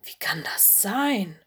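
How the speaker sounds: breathless awestruck whisper; stunned little wolf, barely voiced; stammering in awe. A narrator's reading. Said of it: "stammelte Mino".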